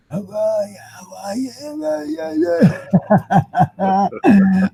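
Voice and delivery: cracked, barely-there croak; voice gone. Retold or imitated. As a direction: squeeze out the words with no voice. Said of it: "It was just gone, just shot, shot to bits".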